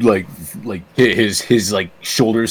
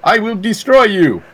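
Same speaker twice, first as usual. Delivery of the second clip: wrestling promo; 6 semitones higher than usual. Pretend. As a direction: threaten your opponent in the ring. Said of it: "You didn't practice this promo?".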